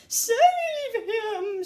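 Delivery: high, drawn-out scream for help; stretched panicked cry. A narrator's reading. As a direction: wail it out in panic. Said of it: "screamed Missus Gloop".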